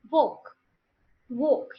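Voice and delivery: walk with a round O and silent L; O-shaped vowel. Shown as a demonstration. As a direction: model walk slightly apart, lips rounded. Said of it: "You make O face".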